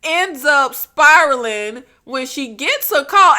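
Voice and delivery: usual speaking voice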